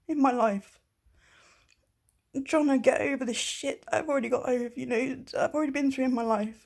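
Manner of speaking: fighting back tears as it spills out; shaking, near tears. Real, not acted. Telling an ex on video how he makes her feel, shaky and close to tears. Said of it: "I'm shaking like a fucking leaf".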